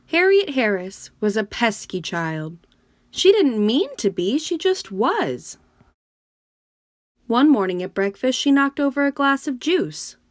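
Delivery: lively, expressive storybook reading; reading to children. A narrator's reading. Teacher reading a picture book to her class, lively and expressive. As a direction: read it like a bedtime story for kids.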